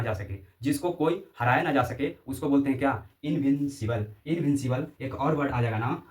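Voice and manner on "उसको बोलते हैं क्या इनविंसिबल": ordinary delivery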